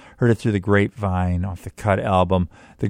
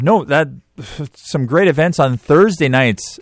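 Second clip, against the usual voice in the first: usual speaking voice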